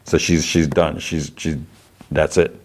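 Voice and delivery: ordinary talk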